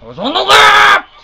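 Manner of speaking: high, loud, drawn-out scream; furious scream. Real, not acted. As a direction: scream it in outraged protest. Fails race again.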